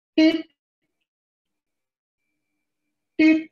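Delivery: high electronic beep imitation; machine beeping. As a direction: mimic the hospital monitor beeping. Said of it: "mesin sudah tit".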